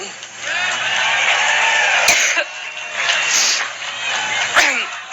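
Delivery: long hacking coughing fit; racking cough, struggling to stop; chesty cough. Real, not acted. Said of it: "once I get over my allergic reaction".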